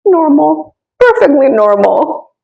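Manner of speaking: high, lilting la la la, unbothered; carefree sing-song. Retold or imitated. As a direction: playfully hum through the chaos. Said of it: "you're calm".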